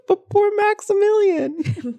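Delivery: very high, slow, pitying whine; mock pity. Fan mourning a movie character, in a high, slow, pitying whine. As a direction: coo it like sad for a puppy. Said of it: "But I was just like".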